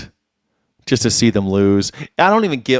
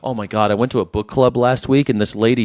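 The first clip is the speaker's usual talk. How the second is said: normal talking voice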